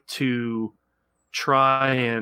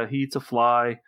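usual speaking voice

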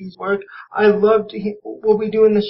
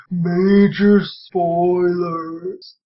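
very slow, stretched whale-speak; 2 semitones lower, much slower than usual. Pretend. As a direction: moan it out like a whale. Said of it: "my whale voice from Finding Dory".

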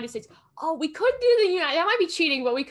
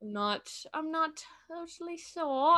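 slightly drawn-out, badly done British accent; accidental British accent; 4 semitones higher, slower than usual. Real, not acted. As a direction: slip lightly into a British accent. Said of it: "I did a British accent".

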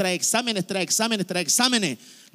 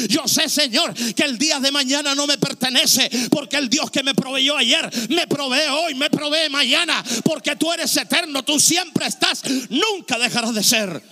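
rising, loud, confident declaration of faith; fervent prayer; 6 semitones higher, 4 dB louder than usual. Acted. Preacher voicing a believer who understands, fervent loud prayer. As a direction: pray with growing certainty.

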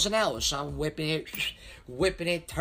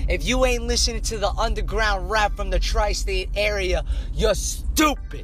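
pumped-up announcer voice, higher and louder; hype outro read; 4 semitones higher, 5 dB louder than usual. Acted. Host signing off listeners, louder, higher hype announcer read. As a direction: hype the show like a radio host. Podcast outro promo over music.

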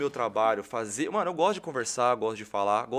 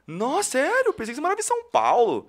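much higher and louder, fast; amazed fan; 10 semitones higher, 7 dB louder than usual. Retold or imitated. Fan learns the host lives here, amazed squeal. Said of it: "Eu falei".

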